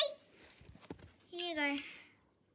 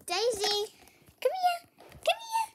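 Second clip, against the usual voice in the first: calling the dog, each call squeakier; rising squeaky come here; 7 dB louder than usual. Real, not acted.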